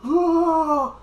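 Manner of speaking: long, high, loud battle scream; mock anime charging-up scream; power-up yell. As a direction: imitate a fighter powering up with a sustained yell. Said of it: "He powers up the Super Saiyan like this".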